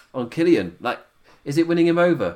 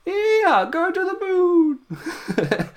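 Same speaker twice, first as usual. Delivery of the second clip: very high, stretched-out mock Southern holler; hammed-up Southern catchphrase, breaking into laughter; slipping Southern accent; much slower than usual. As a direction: whoop it slowly in a shaky-held Southern accent. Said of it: "halfway through the sentence, drop the accent".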